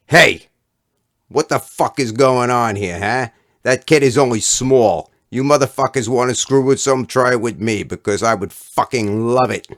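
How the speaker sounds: loud, high, furious yelling; furious defence. Acted. Fat kid berating the punks who cornered the boy, furious yelling. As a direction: tear into the punks.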